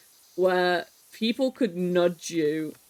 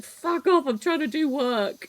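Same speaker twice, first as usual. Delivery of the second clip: higher, snappy mock yell of fuck off; hammed-up annoyance at the pest; mock irritated outburst; 8 semitones higher than usual. Snapping at a mate spamming the nudge button, mock irritated. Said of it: "you'd be like".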